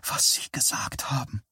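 breathless awed whisper. A narrator's reading. Eileen to Johnny in the hallway, awed whisper. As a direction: hush every word, barely any voice. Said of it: "flüsterte Eileen".

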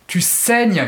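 sharp cry of "Tu saignes"; violent shout. Acted. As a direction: lash out at her with disgust. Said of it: "cria brusquement Sue avec violence".

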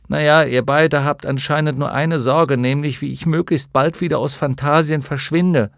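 loud, high-pitched reproach; accusing. A narrator's reading. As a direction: throw the reproach at both friends. Bastian's bitter accusation in a quarrel. (vorwurfsvoll und laut)

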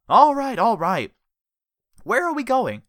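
high, loud hasty surrender; nervous giving in. A narrator's reading. Richard yielding to Jellyfish's knife, high nervous surrender. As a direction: give in quickly, rattled.

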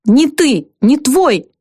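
wife screaming at her husband into a pillow; screamed out. A narrator's reading. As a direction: fling it at him in fury. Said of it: "снова выкрикнула она".

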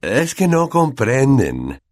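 patronising teacher spelling it out; very slow, condescending. A narrator's reading. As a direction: talk down to them very slowly. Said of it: "hablándoles muy despacio como si fueran tontos".

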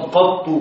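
"قط" with a-vowel, held ta, final u; fatha, doubled ta, damma. Shown as a demonstration. Teacher showing students the best-known form, "قط" pronounced qattu. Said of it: "بفتح القاف وتشديد الطاء مع الضم".

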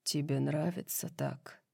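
low, very soft question; hushed lover's voice; intimate murmur. A narrator's reading. Lover asks during sex.

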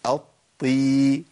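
"الطي" as a held double ط into long i; slow heavy "aṭ-ṭii"; doubled ṭ with kasra. Shown as a demonstration. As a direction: press the ط, then stretch ي. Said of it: "الحالة السابعة والأخيرة".

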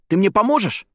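very high, thrilled question; excited, high-pitched eagerness; joyful. A narrator's reading. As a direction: ask with delighted hope. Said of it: "от радости чуть не подпрыгнул".

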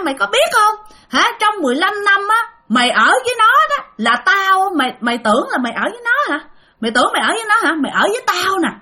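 high, loud scolding ghost voice; possessed wife. Retold or imitated. Possessed wife scolding husband, high and loud. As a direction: berate him like a jealous ghost.

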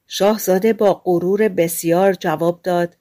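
plain reading voice

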